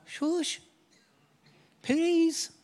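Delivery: a hammed-up meek hush; mock timid shush. Jesus pretending to politely beg the storm, mock timid. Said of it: "He's not going".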